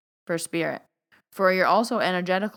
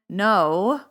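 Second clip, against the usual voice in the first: ordinary talk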